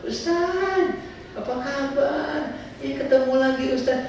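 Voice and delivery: high, delighted mock greeting; gushing greeting. Pilgrim runs into the ustaz again, voiced as an eager high greeting. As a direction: imitate a thrilled pilgrim calling out.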